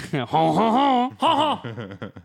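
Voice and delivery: high "hoh-ho-ho" French laugh; hammed-up French cartoon chuckle; drawn-out, high French-style ho-ho laugh; mock French chuckle. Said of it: "You sound like Pierre Escargot".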